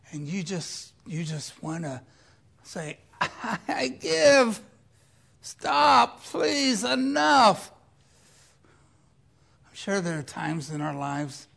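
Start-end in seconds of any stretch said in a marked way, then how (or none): 3.2-7.7 loud, high plea to stop; desperate surrender. Acted. Overwhelmed person begs for it to stop, desperate. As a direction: cry out that you give up. Acting someone overwhelmed giving up.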